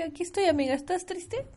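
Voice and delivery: high sweet consoling tone; comforting friend voice. Acted. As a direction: coo it like a caring friend.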